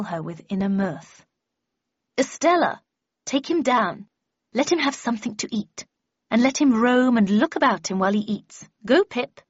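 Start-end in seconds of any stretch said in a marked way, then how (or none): 2.2-9.4 higher, imperious lady giving orders; commanding Miss Havisham. Acted. Miss Havisham orders Estella to take Pip down, imperious. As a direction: dismiss them like a grand mistress.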